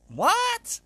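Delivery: sudden high squeak of disbelief; baffled what. Real, not acted. Agony aunt reacting to a strange letter, shrieking in disbelief. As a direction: shriek it in shocked confusion.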